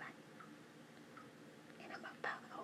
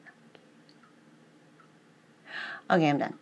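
whispering; 3 semitones lower, 14 dB louder than usual. Real, not acted. Whispers through most of her talk.

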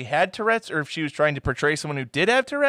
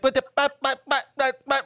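imitating tics; 10 semitones higher, faster than usual.

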